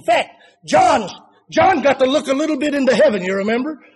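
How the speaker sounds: loud, quick preaching burst; loud pulpit preaching; preaching at full force. Acted. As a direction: launch into it from the pulpit. Preacher building again.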